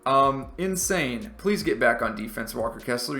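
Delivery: ordinary talk, nothing marked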